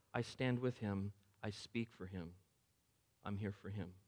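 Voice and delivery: soft, tender reassurance; gentle Jesus. Acted. Jesus defends him before God.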